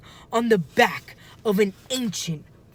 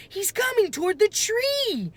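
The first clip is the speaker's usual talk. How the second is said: high panicked cry; frightened cry. A narrator's reading. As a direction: warn of the charging dinosaur. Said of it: "Annie cried".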